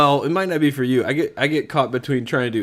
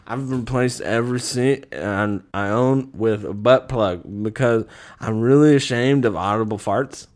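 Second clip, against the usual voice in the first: put-on drunk; 4 semitones lower than usual. Podcaster acting out a card, slurred drunk voice.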